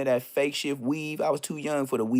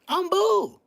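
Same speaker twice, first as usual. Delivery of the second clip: smug refusal; 5 dB louder than usual. Real, not acted. Refuses church.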